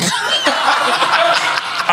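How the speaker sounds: high breathless laughter; breathy laugh. Real, not acted. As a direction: wheeze with laughter. Laughing at favorite story.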